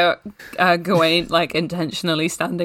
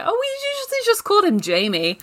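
put-on casual posh girl; 12 semitones higher, faster than usual. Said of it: "And she's like".

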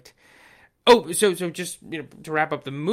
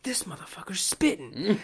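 whispered, hyped-up amazement; awed whisper; 3 semitones higher than usual. Retold or imitated. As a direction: hiss it under your breath, impressed. Retold thought while leaning forward.

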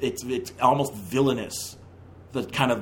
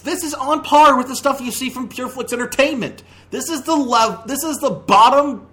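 high, loud, exasperated rant; 10 semitones higher, 4 dB louder than usual. Real, not acted. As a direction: raise it, fed up and mocking. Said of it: "repeats with stronger force".

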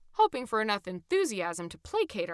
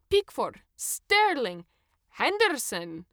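loud, gloating list of names; rubbing it in with each name; the ticket checker gleefully lists England players; 4 semitones higher, 6 dB louder, slower than usual. A narrator's reading. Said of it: "continued in an almost gleeful manner".

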